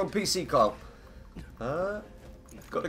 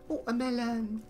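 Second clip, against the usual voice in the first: high-pitched mock exclaim, softer; mock surprise; 9 semitones higher, 7 dB quieter than usual. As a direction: chirp it up high like a silly slip. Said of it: "Magpie are gonna laugh 'cause I said".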